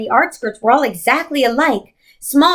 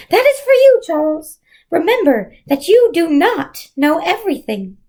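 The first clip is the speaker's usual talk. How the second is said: Mrs Who's voice; 7 semitones higher than usual. A narrator's reading. Mrs Who's farewell to Charles, high and otherworldly. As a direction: speak like a wise spirit on the wind.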